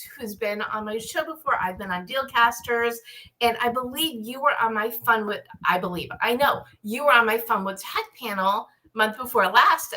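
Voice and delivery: ordinary delivery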